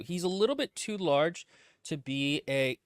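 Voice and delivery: ordinary delivery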